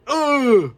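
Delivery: loud, high, strangled groan of frustration; exasperated groan. Retold or imitated. Showing internal screaming, a loud high groan. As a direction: let out a pained ugh. Venting about bad stress.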